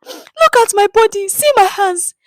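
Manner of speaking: very high, loud plaintive cry; woman showing the crowd her wounds, wailing; pleading woman. A narrator's reading. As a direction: show the crowd your wounds, begging.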